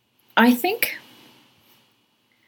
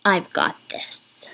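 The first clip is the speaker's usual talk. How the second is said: whisper; 4 dB quieter, slower than usual. Acted. Character reassures birds.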